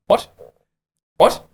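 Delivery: rising bewildered disbelief, jumping up high; imitating a stunned guy who had no clue; baffled what. Groom hearing the news, completely baffled.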